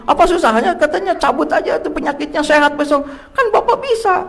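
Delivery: high-pitched nagging wife voice, pitched well up. Retold or imitated. Preacher mimics his wife insisting he just talk to God, in a high nagging voice.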